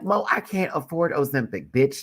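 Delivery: high-pitched mock protest; playful comeback. Creator answering friend's weight-loss tease, high playful protest. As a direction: fire back at a teasing friend.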